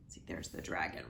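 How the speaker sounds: soft whispered pointing-out; hushed aside while showing the picture. Real, not acted. Aside to listeners over book.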